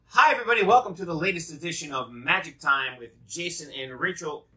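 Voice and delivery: loud, high, bright host opening; show host intro. Acted. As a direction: welcome viewers like a TV host. Opening a home magic show.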